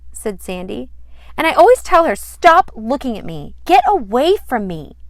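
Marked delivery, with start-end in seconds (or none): 1.4-4.9 put-on high, loud, indignant child; a girl loudly complaining about her classmate; loud complaint. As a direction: whine it out loud and high like an annoyed kid.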